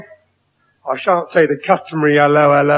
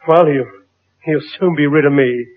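ordinary delivery

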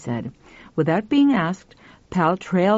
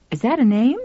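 mildly querulous, higher-pitched question; 6 semitones higher, faster than usual. A narrator's reading. Woman doubting the boy's name, mildly querulous. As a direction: question it with a touch of complaint. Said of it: "she asked querulously".

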